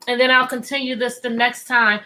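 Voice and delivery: ordinary delivery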